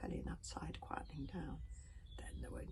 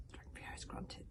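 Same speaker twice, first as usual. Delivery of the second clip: plain reading voice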